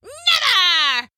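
high snarling shout; drawn-out furious crone cry; witch's yell. A narrator's reading. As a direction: roar a refusal as a witch. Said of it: "yelled the witch".

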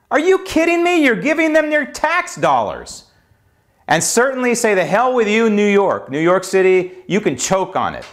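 angry outburst. Real, not acted. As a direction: blow up at New York, voice rising. Ranting against New York.

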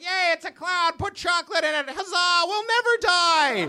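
lightly voiced excited kids; mock gleeful kids. Kids seeing milk in cardboard, in light mock glee. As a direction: cheer like a child with chocolate milk. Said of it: "We're like".

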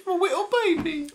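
put-on quick, very high baby squeak; mock baby voice. Co-host echoing the baby role-play, quick high baby voice. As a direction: echo baby talk fast and high.

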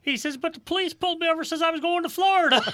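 put-on high, sobbing little boy voice; crying little kid. Retelling how the lost kid explained walking home.

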